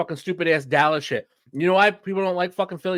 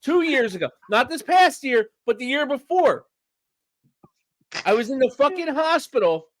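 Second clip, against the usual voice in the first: high-pitched, heated storytelling; worked-up rant; 8 semitones higher than usual. Real, not acted. Fan telling cohosts he watched playoffs from the hospital, heated high rant. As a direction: still wound up, telling it big.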